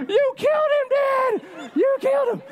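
high-pitched mock child wail of you killed him; kids' accusing scream, played for laughs; imitating kids screaming. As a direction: squeal it like a horrified little kid accusing dad. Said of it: "The kids are screaming".

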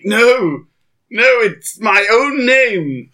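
rising panicked cry, drawn out; mock horror at the grave; horrified. Sees his own grave.

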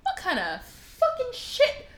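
very high, exasperated disbelief; incredulous outburst. Retold or imitated. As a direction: demand an answer, voice climbing high. Baffled reaction to the character.

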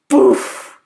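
loud, airy burst of poof; imitated impact "poof". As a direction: puff out a sudden, loud poof. Mimics the impact in retelling.

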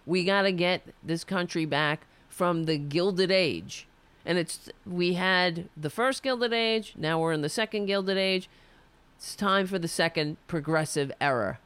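normal talking voice

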